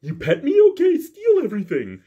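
dopey dog voice. Retold or imitated. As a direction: play the dog who happily gives up. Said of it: "The Bernese Mountain Dog's like".

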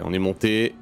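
ordinary delivery

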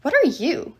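mildly growled question; irritated giant, a hint of growl. A narrator's reading. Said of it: "he growled".

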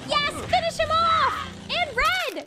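high-pitched, excited cheer; high, punchy celebration. Real, not acted. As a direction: cheer on your side as it wins. Cheering on the fighters.

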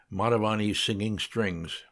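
plain reading voice

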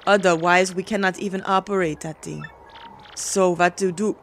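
put-on measured Russian-accented character voice; Russian character, troubled. Russian needing him to operate that thing, troubled character voice. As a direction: play a worried Russian, evenly paced.

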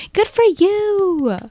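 high, slow mock cheer; a sugary high sarcastic good for you; sarcastic praise. Real, not acted. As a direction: drag it out sweetly, not meaning it. Reacting to a Reddit post.

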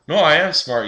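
a hint of an indignant kid insisting; insistent dim boyfriend. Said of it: "He's like".

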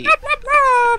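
high, loud, laughing blub noises; shouting underwater. Pretend. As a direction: yell bubbles like you're drowning. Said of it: "can we get a blub blub blub?".

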